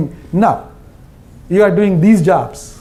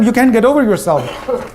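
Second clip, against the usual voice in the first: usual speaking voice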